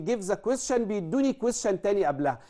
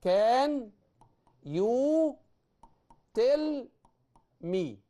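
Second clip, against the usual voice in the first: slow, higher, spaced words; 4 semitones higher, much slower than usual. Shown as a demonstration. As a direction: dictate it word by word. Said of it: "يعني إيه؟".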